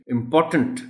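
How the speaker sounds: "امپورٹنٹ" ending in tunt, pitched high; weak final syllable. Shown as a demonstration. Teacher modelling the ending, "امپورٹنٹ" with -tunt. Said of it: "ٹنٹ کی sound ہے آخر میں".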